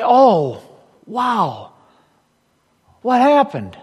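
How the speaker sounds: light, slipped-in Biden impression of a calm Jesus; a hint of an elderly politician musing; Joe Biden voice. Pastor acting out a surprised Jesus, lightly Biden-like. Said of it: "I sound like Joe Biden".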